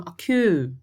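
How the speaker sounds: occur with the second syllable punched; stress on last syllable. Shown as a demonstration. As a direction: lean hard on the final syllable of occur. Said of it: "A sílaba mais forte dele é a última". (occur com a última sílaba mais forte)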